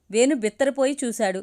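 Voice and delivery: ordinary delivery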